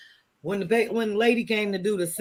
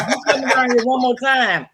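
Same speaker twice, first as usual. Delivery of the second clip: loud, higher mock threat through laughter; laughing mock warning; 6 semitones higher, 6 dB louder than usual. Imagined neighbors warning off the census taker, laughing mock threat. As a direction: warn them off while cracking up.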